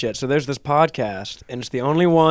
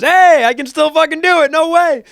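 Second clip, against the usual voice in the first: high, loud cartoon-huckster yell; excited cartoon voice, pitched way up; Eddie voice; 13 semitones higher, 6 dB louder than usual. Actor finds he can still do the voice, excited Eddie voice. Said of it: "could still do the Eddie voice".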